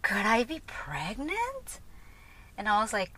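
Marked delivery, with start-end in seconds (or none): 0.0-1.8 dawning realization. Retold or imitated. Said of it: "that's when it hit me".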